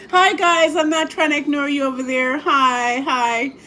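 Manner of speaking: cheerful shout-out to the family over there; calling out. Real, not acted.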